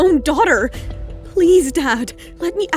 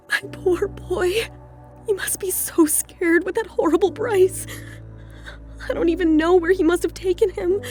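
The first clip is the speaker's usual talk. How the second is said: higher, halting, tearful murmur with a gasp; anguished worry; 3 semitones higher, 5 dB quieter than usual. Acted. Captive mother fears for her missing son, anguished. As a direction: fret aloud between long pauses.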